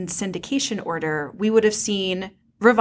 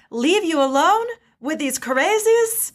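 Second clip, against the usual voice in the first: a high, put-on pirate accent, admittedly unsure; attempted pirate accent; 8 semitones higher than usual. Pirate captain Hondo teasing Obi-Wan, put-on accent. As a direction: try a pirate accent and half-land it. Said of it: "I don't know what accent that was. I was trying".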